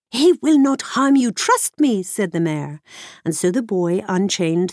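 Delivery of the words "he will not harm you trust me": mare voice. Acted. Mare reassures boy.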